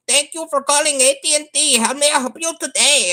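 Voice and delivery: mock Indian accent of an AT&T agent greeting; Indian call-centre accent. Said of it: "they're like".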